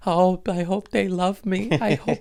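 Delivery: very high, hammed-up anxious fretting; mock nervous wreck. Recalling the beginning of the tour, mock nervous wreck. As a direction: fret up high as a parody of your anxious self. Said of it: "I had meltdowns, nervous wreck".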